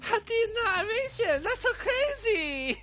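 very high put-on mimic voice; hammed-up high girlish mimicry; mocking girl imitation.

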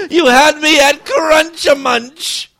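slow, loud, high and crying; hammed-up weepy romance line; mock tearful. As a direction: overplay the tearful love confession.

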